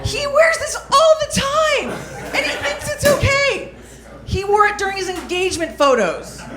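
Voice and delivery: high, strained outrage pushing through laughter; exasperated rant. Acted. Comic telling the crowd about her brother, exasperated and high. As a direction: crack the voice in disbelief at him.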